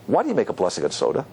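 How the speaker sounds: low, quick, dismissive question; challenging statement. Shown as a demonstration. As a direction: toss it off as a challenge, low and fast. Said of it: "same words in a different way".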